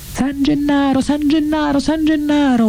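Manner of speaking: very high, wailing call of a frantic crowd; long, high invocations, each one falling at the end; excited crowd howl. A narrator's reading. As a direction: cry out to the saint like a whole crowd gone wild. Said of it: "urlò il popolo eccitato bizzarramente".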